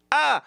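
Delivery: high, staccato laugh; loud fake chuckle ending on a burst; hammed-up boss's laugh; forced laugh. Boss's laugh after sly offer.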